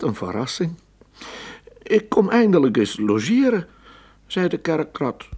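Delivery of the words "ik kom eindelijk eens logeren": high, loud eager rat guest; church rat voice. Acted. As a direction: announce your visit cheerfully as the visiting church rat. Church rat arrives to visit.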